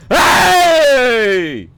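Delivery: sharp loud high "Hei!"; sudden shout. Real, not acted. Streamer shouting at the chat in the middle of a game, sharp high yell. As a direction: bark it out high.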